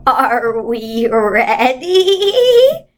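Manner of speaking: very slow, drawn-out eerie question; Missus Witch. Acted. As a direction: draw it out like something ancient.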